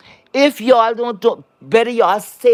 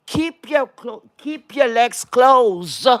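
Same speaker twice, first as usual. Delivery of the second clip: each word slowly drawn out; slow emphatic advice; 4 semitones higher, slower than usual. Real, not acted. Host lecturing viewers on dating, slow emphatic warning. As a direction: spell it out slowly so it sinks in.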